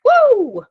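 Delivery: sudden high loud whoop; mock alarmed whoop. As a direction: yelp as if yanked backward. Trigger sends you to past.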